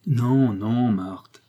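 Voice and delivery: a hint of gloom, cutting his wife off; darkly interrupting, understated; sombre. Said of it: "interrompit-il d'un air sombre". (d'un air sombre, sans trop appuyer)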